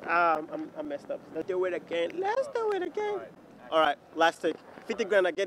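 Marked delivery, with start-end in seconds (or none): 2.2-3.2 high playful squeak; squeaky high voice. Pretend. Repeated in a squeaky voice.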